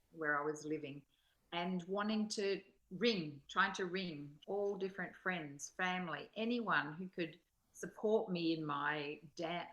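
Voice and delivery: ordinary delivery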